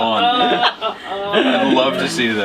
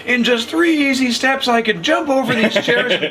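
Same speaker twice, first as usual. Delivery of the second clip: mock pitchman; 6 semitones higher than usual. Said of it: "he's like".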